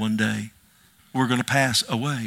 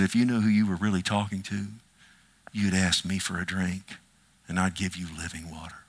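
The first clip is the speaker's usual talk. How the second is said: lightly acted Jesus, softer and lower, trailing down; gentle offer; 5 semitones lower, 5 dB quieter than usual. Jesus offering the woman at the well living water, gentle. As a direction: make a gentle, hushed offer, lower as it goes.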